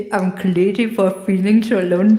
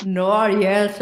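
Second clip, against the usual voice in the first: ordinary talk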